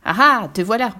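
loud triumphant call; loud gotcha. A narrator's reading. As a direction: catch him by surprise. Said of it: "fait une voix derrière lui".

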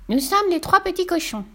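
very high, squeaky piglets; little pig voices. A narrator's reading. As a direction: chirp it like tiny pigs. Pigs introduce themselves.

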